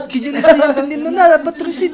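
high, loud mock baffled pastor; hammed-up puzzled preacher; confused pastor. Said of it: "Hay un pastor me decía".

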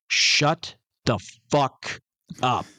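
slow, word-by-word retold rebuke; emphatic retold telling-off. Man telling off noisy kids in a cinema, slow and forceful. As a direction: space out each word for force. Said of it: "Like that, right?".

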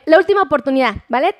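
ordinary delivery

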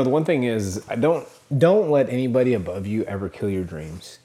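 normal talking voice

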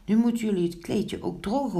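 high, quick instruction; calling out. A narrator's reading. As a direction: call out a brisk order up high. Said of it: "riep Pinkeltje". (hoog en vlot roepend)

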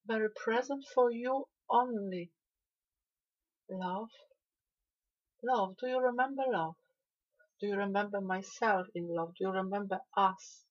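fervent proclamation. Acted. Channeler proclaiming love to the listener, fervent loud preaching. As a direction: proclaim it like a revelation rising to a peak.

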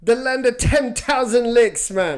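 high, loud, slow Jamaican impression; hammed-up Jamaican; Jamaican accent. Shown as a demonstration. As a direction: stretch it out in a big Jamaican lilt. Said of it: "do a good Jamaican one".

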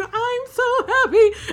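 high, drawn-out tearful wail of joy; hammed-up happy blubbering; mock happy outburst. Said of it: "you'll get these little spurts".

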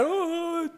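high mock wail; high-pitched imitation sob; imitated crying. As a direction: wail like a crying little boy. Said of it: "And the boy's just crying".

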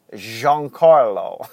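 Giancarlo drawn out slow, lingering on each syllable; savoring Giancarlo. Shown as a demonstration. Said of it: "I like saying Giancarlo".

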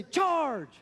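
rally the troops, hammed up; mock battle cry. Pastor rallies the congregation.

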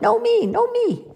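put-on high-pitched childlike insisting; squabbling shouts. A narrator's reading. Two friends arguing over who is captain, high squabbling shouts. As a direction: shout it high, competing to be picked. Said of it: "And they shout and shout".

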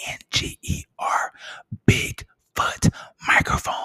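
loud stage whisper selling a microphone; conspiratorial whispered podcast mic ad; whispered mock ad. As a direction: hiss the pitch like a secret sales ad.

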